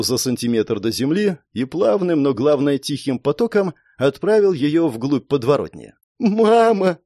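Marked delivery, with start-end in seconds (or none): 6.2-7.0 put-on high, tearful boy's wail; crying child. A narrator's reading. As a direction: cry out for mum as a little boy. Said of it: "заплакал мальчик". (плачущий детский крик)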